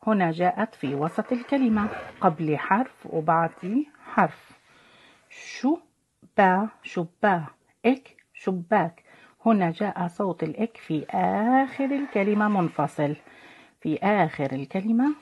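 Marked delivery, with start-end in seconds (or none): 5.3-9.0 شبا and شباك said slowly, syllable by syllable; blending syllables. Shown as a demonstration. Teacher blending syllables into شباك for children. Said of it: "ميزوا معي".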